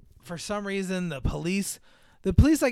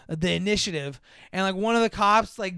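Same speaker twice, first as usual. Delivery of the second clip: normal talking voice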